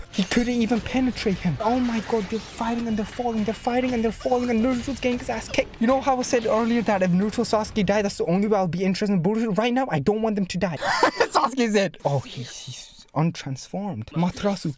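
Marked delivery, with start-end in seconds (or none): none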